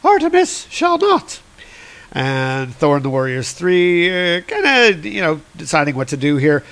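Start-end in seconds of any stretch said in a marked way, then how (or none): 0.0-1.4 much higher put-on character voice; retold goddess's retort. Goddess refusing a bet, high put-on voice. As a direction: lift the quote into a high pitch.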